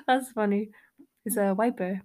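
ordinary talk, nothing marked

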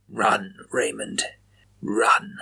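slow whispered urging under the breath; said to himself. A narrator's reading. Chaplin urging Raymond on under his breath, whispering slowly. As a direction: coax him on in a slow whisper. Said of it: "Chaplin said to himself".

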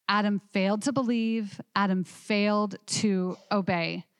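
usual speaking voice

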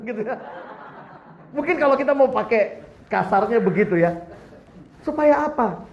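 ordinary talk, nothing marked

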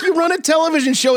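fast, high-pitched indignant voice; quick high protest. Retold or imitated. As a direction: blurt it out in a squeak. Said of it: "they're like".

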